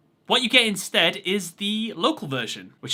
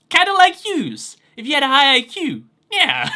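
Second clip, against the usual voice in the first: put-on Brooklyn accent; 8 semitones higher, 7 dB louder than usual. Ad-lib as Joey, laughing.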